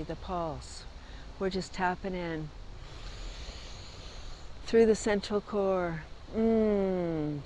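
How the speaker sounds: calm meditation guide, gently. Acted. Leads a breathing exercise.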